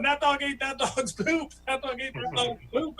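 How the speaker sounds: put-on high little-kid voice; imitated four-year-old. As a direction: repeat it gleefully like a small daughter. Four-year-old daughter keeps repeating.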